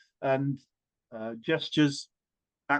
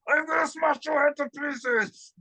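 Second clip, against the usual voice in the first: booming Thor; 12 semitones higher than usual. Shown as a demonstration. Storyteller repeats Thor's threat, roaring. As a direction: bellow it like a furious god. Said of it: "You become Thor for that split second".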